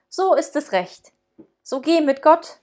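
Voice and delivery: lightly voiced Christkind sending him off; Christkind's voice. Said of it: "Christkindlein sprach".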